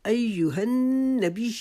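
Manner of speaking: ایہا النبی with the نّ held one alif long; held nasal noon. Shown as a demonstration. Teacher showing the ghunna in un-Nabi. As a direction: hum the ن of un-Nabi. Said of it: "ایک الف کی مقدار رکنا ہے".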